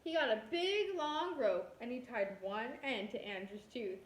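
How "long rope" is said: ordinary delivery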